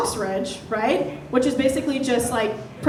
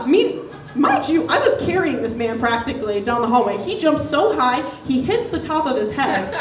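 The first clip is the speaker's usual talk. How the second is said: ordinary talk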